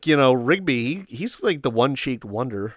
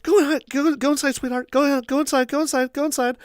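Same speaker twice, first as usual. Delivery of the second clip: urgent mother; 13 semitones higher than usual. Retold or imitated. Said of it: "And she's like".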